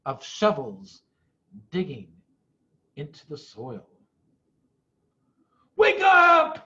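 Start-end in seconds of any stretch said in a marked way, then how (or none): 5.8-6.6 loud high alarmed shout. A narrator's reading. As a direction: rouse the sleeping family. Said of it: "he shouted".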